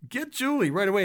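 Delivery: family comforting Julie after the spill, cheerful; light reassuring. Retold or imitated. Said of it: "They were laughing and going".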